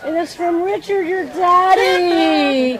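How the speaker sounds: high, slow voice breaking into real tears; choking up over the gift; tearful. Real, not acted. Touched woman tells the girl the flowers came from her daddy, crying. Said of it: "Now I'm gonna start crying again".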